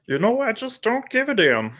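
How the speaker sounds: high, strained voice; Jordan Peterson impression. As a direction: crack the voice up high. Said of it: "do the Jordan Peterson".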